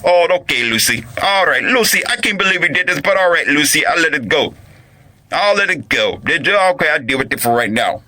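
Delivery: high-pitched mock resignation; whiny imitation of a man relenting; caving-in husband. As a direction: give in like a put-upon husband. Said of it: "do the same thing that Ricardo did".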